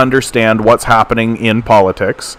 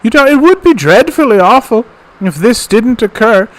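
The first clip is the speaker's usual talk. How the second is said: put-on sweet old lady voice; 8 semitones higher than usual. Streamer imitating Mom's public persona, high sweet old lady. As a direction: coo it like a doting grandma. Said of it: "Then she talks like this".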